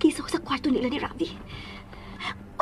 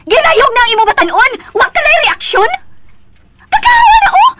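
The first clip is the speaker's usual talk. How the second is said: loud, relentless shouting tirade; husband yelling at his wife about their child; angry yelling; 2 semitones higher, 6 dB louder than usual. Acted. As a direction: hammer her with accusations. Husband yelling during quarrel.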